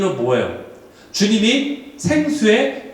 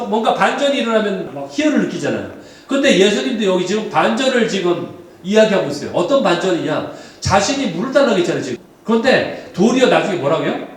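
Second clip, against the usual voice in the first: normal talking voice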